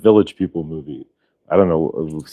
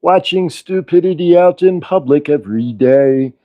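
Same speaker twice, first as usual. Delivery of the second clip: slow sung parody in a puppet frog voice; Kermit; 3 semitones higher, slower than usual. Acted. Doing the show as a puppet frog, slow singsong impression. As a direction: croon it slowly as the frog puppet. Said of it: "we could do the show in Kermit".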